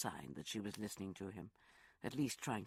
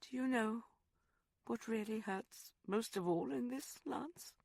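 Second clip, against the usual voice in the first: Rosalind, sorrowful; 10 semitones higher than usual. Acted. Woman asks lover what hurts most, high and sorrowful. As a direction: ask him gently what hurts most. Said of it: "more sorrowful than angry".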